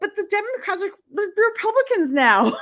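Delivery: high-pitched, playful protest; mock arguing. Acting out her own retort to her friend, mock arguing. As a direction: argue back in a hammed-up high voice. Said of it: "And I'm like".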